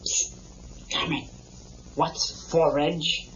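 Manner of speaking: hushed, breathy question; quiet psst to Kermit; whispered aside. Real, not acted. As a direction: lean in and ask under your breath.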